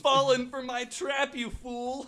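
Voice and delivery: high, loud mock villain crowing; villain gloat. Cohost voicing the house mocking trapped ghosts.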